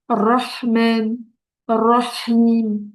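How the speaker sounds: "Ar-Rahman", "Ar-Rahim" drawn out slowly with audible H; stretched "Ar-Rahman", "Ar-Rahim", H clearly breathed from the throat; pronounced Arabic H. Shown as a demonstration. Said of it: "Il y a bien le ha".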